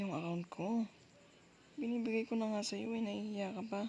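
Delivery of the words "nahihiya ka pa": normal talking voice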